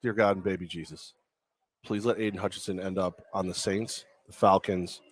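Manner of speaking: a half-joking Sunday prayer; mock prayer. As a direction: pray in jest, hushed and reverent. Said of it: "I'm gonna do a quick prayer".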